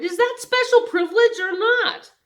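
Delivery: put-on boy shouting to his teacher about privilege; shouted. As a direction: yell it in outrage. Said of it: "Homer Macaulay shouted".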